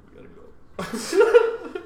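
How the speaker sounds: high, slow, laughing "we gotta go"; laughing panic. Retold or imitated. Friends wanting off the train, high laughing panic. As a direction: squeal it out while laughing.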